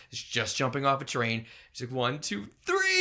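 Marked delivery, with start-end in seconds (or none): 1.9-3.0 mock-dramatic jump countdown; acted countdown. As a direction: build to a big leap on the last number. Acting out countdown before a jump.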